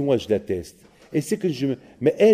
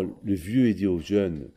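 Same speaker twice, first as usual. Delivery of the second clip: normal talking voice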